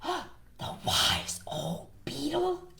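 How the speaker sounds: slow, awestruck gasp of a question; gasp, then stretch each word in disbelief; hammed-up shock. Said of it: "gasped all the animals".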